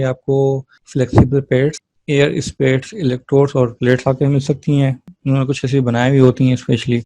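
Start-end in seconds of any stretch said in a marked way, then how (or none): none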